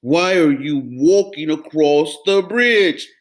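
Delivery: loud, gruff troll yell; troll yelling at the little goat. Acted. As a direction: bellow down the bridge. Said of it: "yelled the hideous".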